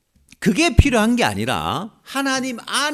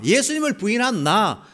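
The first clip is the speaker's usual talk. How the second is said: usual speaking voice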